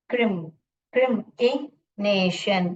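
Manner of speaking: क्रिमिनेशन slowly broken after क्रिम; slow क्रिम-इ-नेशन with a short i; syllable by syllable. Shown as a demonstration. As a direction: sound out क्रिम, then stretch out क्रिमिनेशन. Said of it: "ई sound कराना है".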